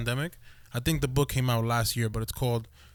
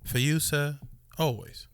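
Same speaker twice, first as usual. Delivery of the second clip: Jarvis voice. Acted. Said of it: "why did you say it like that".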